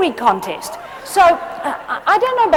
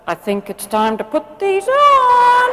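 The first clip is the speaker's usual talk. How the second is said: higher, slow mock-sweet parent voice; teasing parent; 6 semitones higher, slower than usual. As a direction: drawl it out sweetly to tease the kid. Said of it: "You go".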